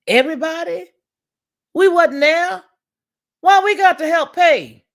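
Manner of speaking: loud, high, indignant, slowing on the last question; outraged shout. Real, not acted. Ranting to her viewers about paying for others' babies, shouting. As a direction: yell it like you're fed up paying for everyone.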